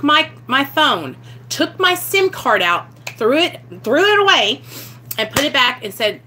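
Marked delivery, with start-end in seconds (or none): none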